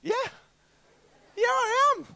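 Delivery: high, strained blurted yes; nervous lie. Retold or imitated. He lies to the stranger, high and strained. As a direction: squeak out the lie.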